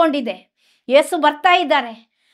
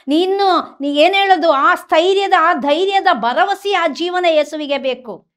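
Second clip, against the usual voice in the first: fervent preaching; 4 semitones higher, 3 dB louder than usual. Acted. Preacher assures listeners Jesus wants their heart, in fervent preaching. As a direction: proclaim it like a revival preacher.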